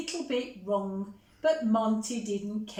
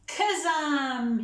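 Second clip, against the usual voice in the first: louder and higher magic word; cried out; 5 semitones higher, 4 dB louder than usual. Acted. Monty casting his vanishing trick, cried out. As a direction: cast the spell with a flourish. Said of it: "he cried".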